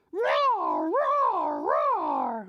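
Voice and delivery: put-on loud, playful dinosaur roar for kids. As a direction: roar like a big cartoon dinosaur. Dinosaurs swoop in from above.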